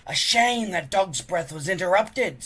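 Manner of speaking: high, loud mocking sneer. Bully Snotlout taunting Hiccup, sneering. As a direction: sneer it at the weakling. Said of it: "sneered Snotlout".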